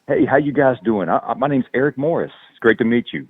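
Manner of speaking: neutral Midwest, Southern twang flattened out; Midwest accent. Shown as a demonstration. Southern actor demos his neutral Midwest voice for hosts. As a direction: iron out every Southern vowel. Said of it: "super trying to sound like I'm from out, you know, Midwest".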